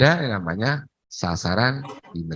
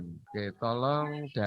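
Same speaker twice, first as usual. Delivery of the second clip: bad connection; 10 dB quieter, slower than usual. Real, not acted. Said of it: "Suaranya terlalu kecil".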